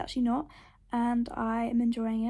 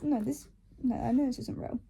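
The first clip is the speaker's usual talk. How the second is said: ordinary talk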